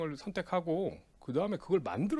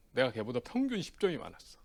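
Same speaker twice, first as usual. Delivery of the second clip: ordinary talk, nothing marked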